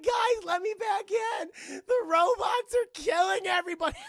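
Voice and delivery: hammed-up crying shriek; crying scream. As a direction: wail to be let back in. Pleading to be let in.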